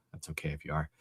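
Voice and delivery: soft reassuring whisper to the chat. Real, not acted. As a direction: drop to a quick conspiratorial whisper. Aside about King James only.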